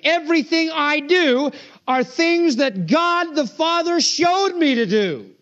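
high, slow, insistent rebuttal; emphatic retort. Retold or imitated. Preacher voicing Christ answering Sabbath accusers, emphatic retort. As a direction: argue back up high, stretching the key words.

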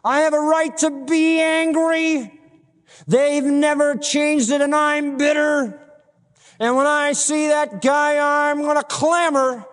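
high, drawn-out mock protest; acted aggrieved complainer. Preacher voicing an unforgiving believer, indignant protest. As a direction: plead your grievance high and stretched out. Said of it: "You say, "Oh man".

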